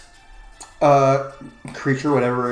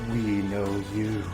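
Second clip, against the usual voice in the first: eerie mind voice; 5 semitones lower, 9 dB quieter than usual. Acted. Patron voice heard in mind.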